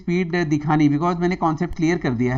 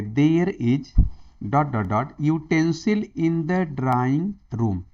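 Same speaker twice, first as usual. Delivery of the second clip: very slow, spelled-out read with a blank; drawn-out question reading; much slower than usual. Shown as a demonstration. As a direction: read the gap as dot dot dot, slowly. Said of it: "reading the blank slowly".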